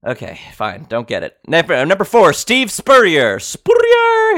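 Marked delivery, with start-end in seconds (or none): 3.4-4.3 very high, loud whoop of a name; playful high-pitched yell; hyped holler. Real, not acted. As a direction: holler the name like a hype man.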